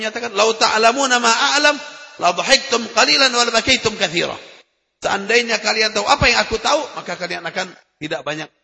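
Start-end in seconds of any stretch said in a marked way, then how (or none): 0.3-4.3 raised, measured Arabic recitation of a hadith. Acted. Preacher recites the hadith to the congregation, solemn and measured.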